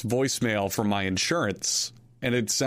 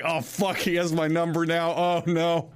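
mock terrified; 5 semitones higher than usual. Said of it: "I was so terrified".

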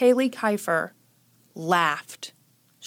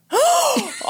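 startled gasp. Real, not acted. Stink bug startles her.

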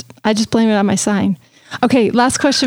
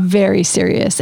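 usual speaking voice

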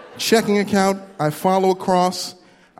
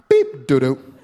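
card machine beep; slower than usual. Retold or imitated. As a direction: imitate the card reader rejecting you. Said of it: "the machine is saying doo-doo".

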